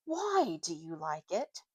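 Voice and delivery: low, slow, sulky schoolboy; Eustace's voice. Acted. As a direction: drag the question out doubtfully. Eustace voiced, sneering question.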